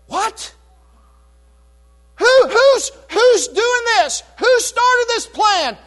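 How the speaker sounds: high, loud, slow shocked demand; loud, high king in disbelief; shocked outrage. Retold or imitated. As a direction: demand who did this, stunned.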